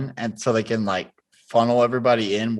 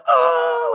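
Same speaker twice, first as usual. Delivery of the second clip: very high, louder mock scream; imitated panicked scream; 6 dB louder than usual. Friends screaming at Garrett at the border, high mock scream. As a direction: re-enact a freaked-out shriek. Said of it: "we're, like, screaming at".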